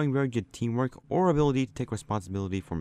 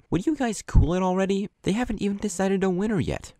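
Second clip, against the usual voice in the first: put-on higher, exasperated girl voice; lightly voiced girl; 10 semitones higher than usual. Girl from the other team hushing the boys, higher exasperated. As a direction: tell them to cool it, higher. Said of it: "she got involved".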